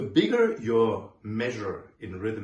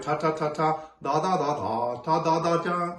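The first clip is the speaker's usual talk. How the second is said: teacher shows the uneven tonguing to avoid; uneven articulation; 5 semitones higher, 6 dB louder than usual. Shown as a demonstration. The wrong way, clashing "ta" and "da". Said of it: "you want to avoid".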